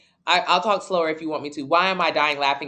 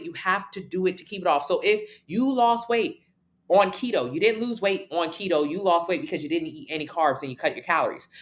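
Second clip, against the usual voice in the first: ordinary talk, nothing marked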